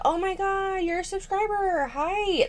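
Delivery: high, soft put-on fangirl squeal; mock gushing greeting. YouTuber greeting a subscriber at her house, mock gushing. Voices imagined welcome for a fan.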